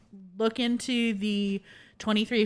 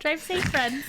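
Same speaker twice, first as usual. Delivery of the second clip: imitating Steph; 6 semitones higher than usual. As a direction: shout the friend's usual goodbye. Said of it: "Steph always ends it the same way".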